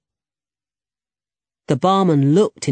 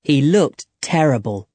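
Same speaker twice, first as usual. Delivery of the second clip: plain reading voice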